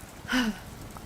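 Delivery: long, heavy sigh; deep sigh. Acted. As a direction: let out a sorrowful sigh. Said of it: "with a deep sigh".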